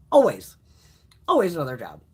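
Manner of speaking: loud, firm single word; emphatic. Real, not acted. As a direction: slam it down. Emphatic repeat.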